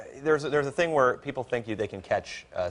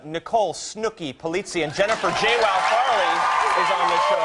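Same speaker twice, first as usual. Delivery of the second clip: loud, higher showbiz announcement; excited announcing; 6 semitones higher, 5 dB louder than usual. Real, not acted. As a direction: hype up the guest list.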